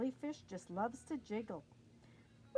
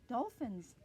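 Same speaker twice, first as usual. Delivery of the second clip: ordinary delivery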